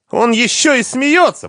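very high, loud indignant yell; furious ex-wife; outraged scream. A narrator's reading. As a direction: shriek it, pointing him out. Hysterical quarrel, wife screaming.

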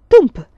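loud, high imitated thump; explosive banging noise; bench thump. A narrator's reading. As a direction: punch out the bang of wood.

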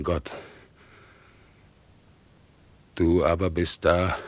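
forceful, deliberate renunciation; solemn defiance. Acted. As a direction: hurl each phrase at God, firmly. Defies God, refusing mercy.

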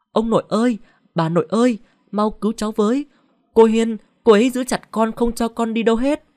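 kêu cứu cao giọng, lớn tiếng; gào gọi ông bà nội từ xa. A narrator's reading. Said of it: "Nó gọi to". (Thẫm gào lên cầu cứu ông bà nội, cao và to)

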